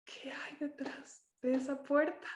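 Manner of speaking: soft, solemn wondering question; acted-out reverent hush; hushed awe. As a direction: breathe the question out quietly, in awe. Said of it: "respirando el momento súper solemne".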